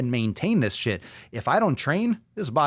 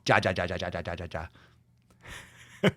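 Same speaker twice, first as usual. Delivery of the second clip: imitated typed laughter, trailing off softer; Spanish text laugh; 4 semitones lower, 4 dB quieter, much faster than usual. Host quoting someone laughing on text, mock Spanish laugh. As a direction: rattle off the "ja" syllables like laughter. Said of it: "someone who speaks Spanish and they laugh".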